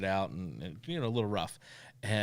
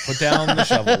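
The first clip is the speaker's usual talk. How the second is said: much higher and louder, a hammed-up yell; mock alarmed shout; 13 semitones higher, 12 dB louder than usual. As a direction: shout it like a panicked friend in jest. Mock alarm at co-host's slip.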